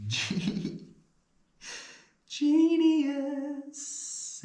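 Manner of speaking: ordinary talk, nothing marked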